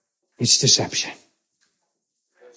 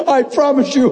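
ordinary talk

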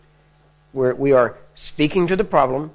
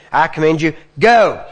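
wrong way, just yelling; 2 semitones higher, 5 dB louder than usual. Shown as a demonstration. Preacher showing the wrong, throat-yelled command, loud. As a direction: shout it from the throat. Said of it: "You don't say".

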